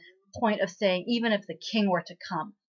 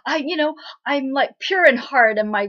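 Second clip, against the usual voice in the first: high, loud indignant retort; mock upset protest; 7 semitones higher, 10 dB louder than usual. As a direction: protest hotly as the offended hero, hammed up. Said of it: "Kangchi gets all upset".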